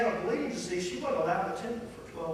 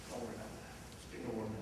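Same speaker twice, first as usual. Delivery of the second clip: soft, low, reassuring; Jesus reassuring the grieving father, softly; gentle reassurance; 8 semitones lower, 13 dB quieter, faster than usual. Retold or imitated. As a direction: comfort him quietly.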